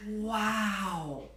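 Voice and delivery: sharp gasp, then a long drawn-out wow; acting out being amazed; awed gasp. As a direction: gasp in wonder, stretch the word out. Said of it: "and going".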